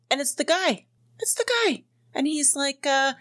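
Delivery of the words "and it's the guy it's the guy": rising high, thrilled realization; excited disbelief. Real, not acted. Telling listeners the guy called, excitedly high. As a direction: squeal out who is calling.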